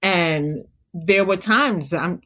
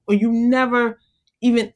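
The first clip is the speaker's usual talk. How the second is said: normal talking voice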